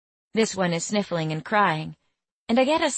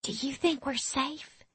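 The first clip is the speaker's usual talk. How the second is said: put-on high, soft Southern-accented worry; worried; 8 semitones higher, 8 dB quieter than usual. A narrator's reading. Worried girl asks if they are safe, soft high voice. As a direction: ask softly, seeking reassurance. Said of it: "Willa asks me worried".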